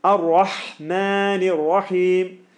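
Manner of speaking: Ar-Rahmanir Rahim recited slowly; slow recitation. Shown as a demonstration. Teacher reciting slowly for the class. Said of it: "recites the Basmala ending".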